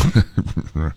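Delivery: a short quiet chuckle; soft laugh. Real, not acted. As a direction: laugh it off softly. Laughs at co-host.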